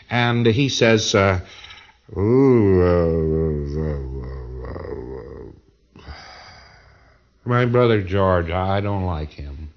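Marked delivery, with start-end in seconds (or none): 2.2-9.7 hesitant patient answering. Retold or imitated. Auditor asks psychotic patient a question.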